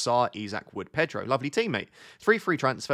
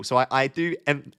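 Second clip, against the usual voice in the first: ordinary delivery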